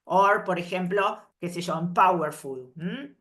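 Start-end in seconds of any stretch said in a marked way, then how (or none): none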